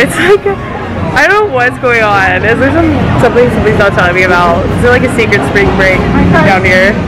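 loud excited telling; talking loudly over the crowd. Real, not acted. Loud excited vlog rant over noise.

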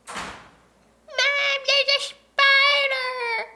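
put-on high, slow, frightened child crying out; scared kid. Mom imitating her scared kid shouting about a spider. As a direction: wail it like a terrified little kid. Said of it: "they're gonna be like".

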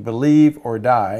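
loud, slow mock threat; retold scream. Cartoon zealot throttling a man, mock scream. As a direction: draw out a hammed-up yell. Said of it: "and he's screaming".

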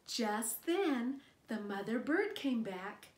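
put-on gentle read-aloud voice for a little child; storybook narration. Narrator reading a picture book to a child, gentle read-aloud. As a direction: read it like bedtime story time.

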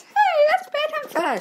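very high mock audience protest; squeaky audience voice. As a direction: pipe up like an offended fan, way up high. Voicing fake audience member.

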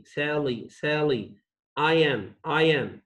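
ordinary delivery

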